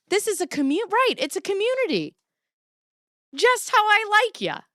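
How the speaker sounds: high, bright gush of glee; gleeful. Real, not acted. To her viewers, gushing high with glee. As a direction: squeal it with delight.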